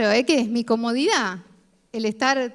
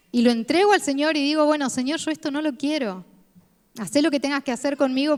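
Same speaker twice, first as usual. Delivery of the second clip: louder, driving preacher's voice; full-force preaching; 3 semitones higher, 5 dB louder than usual. Acted. Preacher urging the congregation to surrender sin, preaching loud. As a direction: preach it out to the church with conviction.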